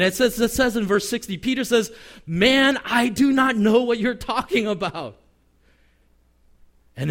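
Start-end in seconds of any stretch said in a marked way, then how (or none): 2.3-5.2 high, loud protest of innocence; heated mock denial, leaning on each word; emphatic acted denial. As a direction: imitate an exasperated man insisting he knows nothing. Peter's last, emphatic denial acted.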